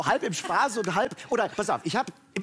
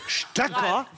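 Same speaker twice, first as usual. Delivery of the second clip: incredulous question; 5 semitones higher than usual. Real, not acted. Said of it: "das hat sie doch jetzt nicht gesagt".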